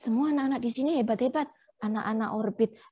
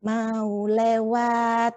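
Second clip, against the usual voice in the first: slow polite prompt; much slower than usual. Shown as a demonstration. Teacher models asking to pass.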